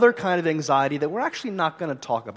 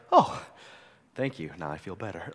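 sarcastic thanks; 7 semitones lower than usual. Retold or imitated. Pastor imitating an angry person told to calm down, sarcastic. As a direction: thank them with heavy mock gratitude. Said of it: "You're like".

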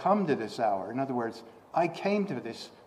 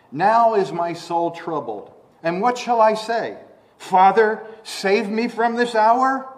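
raised, questioning cry to the Father; troubled plea; 7 semitones higher, 8 dB louder than usual. Acted. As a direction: call out higher and louder, ending on a question. Acting out Jesus' troubled prayer.

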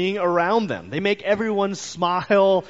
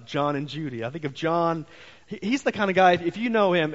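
normal talking voice

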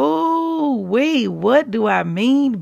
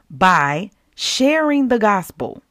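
ordinary talk, nothing marked

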